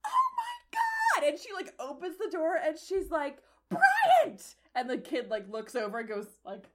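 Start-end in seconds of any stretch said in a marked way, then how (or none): 0.1-1.3 very high, loud gasp of horror; shocked mother. Acted. Mother spots the princess bathing outside, horrified. 3.7-4.5 very high, loud shriek of the name; scolding yell. Acted. Mother calls her son from the door, shrieking.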